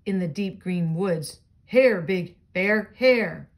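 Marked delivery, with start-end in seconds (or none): 1.7-3.5 loud, slow calling out; misheard tree call. Acted. Reading a picture book to a child, the tree booms slow and loud. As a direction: boom it out slowly like the tree. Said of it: "He thought Tree said".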